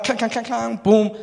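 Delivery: loud, high clanging and a boom; imitated clanging. Landlord clearing the toilet, noisy imitated clanging. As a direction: act out noisy plumbing work.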